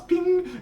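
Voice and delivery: very high, ringing "ping"; high-pitched phone chime; imitated phone ping. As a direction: mimic a phone's message alert. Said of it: "you hear Moopsy's phone buzz".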